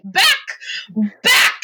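sharp acted command to dogs; commanding call. Musher trying to control his dogs, commanding call. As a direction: call the dogs back firmly.

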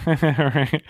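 nervous laugh. Real, not acted. Laughing at teasing.

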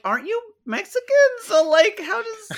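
high mocking voice, cracking into a laugh; mocking retort. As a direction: needle him in a squeaky disbelieving voice. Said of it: "But I'm like".

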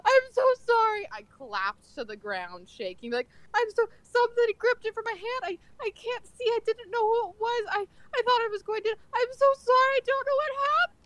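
high, fast, sobbing apology; fake crying. Polakwi sobs to the shop owner, pleading and crying. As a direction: wail it out like a broken old lady. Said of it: "I'm full out crying".

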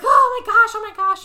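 high, loud acted panic; mock freak-out. Girls hearing ghost noises, mock freak-out. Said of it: "and freaking out".

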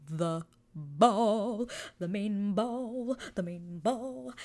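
drawn-out, wavering dramatic announcement; dramatic hype. Real, not acted. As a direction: stretch each "ball" out with relish. Hyping her favourite scene.

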